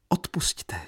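high, acted tearful apology; tearful plea. A narrator's reading. Katerina begging forgiveness, narrated as tearful. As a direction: plead high, as if weeping. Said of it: "opakovala Katerina plačíc".

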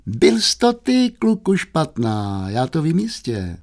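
high, drawn-out start settling down; accusing. Acted. Youth accusing his brother over book, slow high accusation.

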